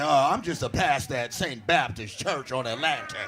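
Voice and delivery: low-pitched pastor impression; imitated audience member. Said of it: "He like".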